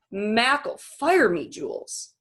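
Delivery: slow, heavy protest; a hint of a worried father; worried dad. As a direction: draw it out in dread. Father worried about job.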